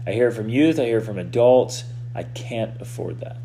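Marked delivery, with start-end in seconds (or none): 2.1-3.4 whispered resignation. Retold or imitated. Quoting a defeated statement.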